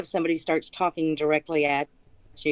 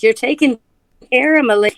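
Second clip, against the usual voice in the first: high, loud indignant royal protest; mock queen's outrage; 9 semitones higher, 9 dB louder, faster than usual. Guest mimics the rival queen at the fair, hammed-up outrage. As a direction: play a huffy offended queen. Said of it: "It's like".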